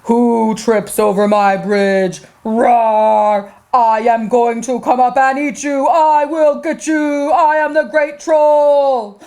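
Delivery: deep, slow growling troll. Acted. Troll challenges whoever crosses the bridge, deep slow menace. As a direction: roar it low and drawn out, rising as you go. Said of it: "The troll said".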